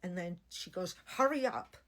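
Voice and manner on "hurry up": sharp, high, louder command; urgent order. Retold or imitated. Surgeon telling the operating team, a loud urgent order. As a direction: snap it out to rush the team.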